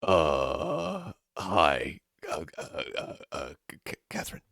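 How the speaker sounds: stuttering, trailing off to a breathless mumble; nervous stammer. Acted. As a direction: stammer out her name, too flustered to speak. Nervous Gerald meets Catherine.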